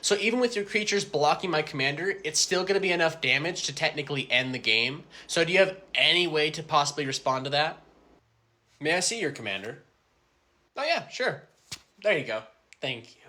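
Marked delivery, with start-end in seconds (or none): none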